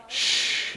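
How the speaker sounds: soft unvoiced shh; shushing. Shown as a demonstration. Pastor showing kids what shh means, soft shush. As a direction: hush them with a shh. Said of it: "it means be quiet".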